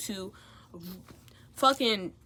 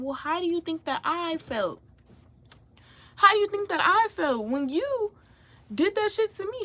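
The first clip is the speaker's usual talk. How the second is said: rising high, louder indignant demand; retold heated question; 9 semitones higher, 4 dB louder than usual. Confronting the roommate, indignant and loud. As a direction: throw her own words back at her.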